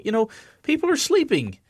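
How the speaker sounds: high-pitched mock complaint; annoyed sleeper. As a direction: protest like someone disturbed in bed. Voicing the annoyed man in bed.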